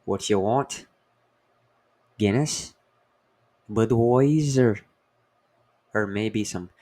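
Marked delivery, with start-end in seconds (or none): 0.0-4.8 lightly voiced Irish barman, louder on Budweiser; a hint of a pub barman in Ireland offering beers; mild Irish accent asking what you want. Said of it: "in Ireland, they would be like".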